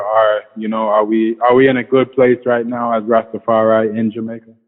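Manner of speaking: ordinary delivery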